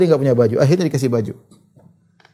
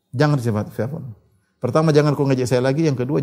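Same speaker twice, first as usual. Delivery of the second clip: ordinary talk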